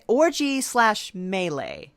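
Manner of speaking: usual speaking voice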